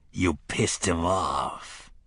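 low, slow, blunt remark; deadpan put-down; dry gruff instructor. A narrator's reading. Cort to wounded Roland, low and blunt. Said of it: "Cort said".

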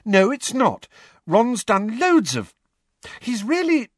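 high-pitched defence; flustered. A narrator's reading. Hermione defending Ron to George, flustered. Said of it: "blushing harder than ever".

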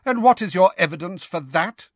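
high, challenging question; McGonagall, cutting in. A narrator's reading. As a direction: interject sharply, demanding proof. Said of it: "cut in Professor McGonagall".

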